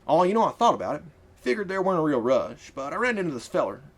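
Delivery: lightly voiced easygoing man. Marcus the carriage driver.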